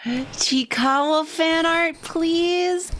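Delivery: squeaky high begging voice; high squeaky plea. Acted. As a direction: plead in a tiny high voice. High pleading request.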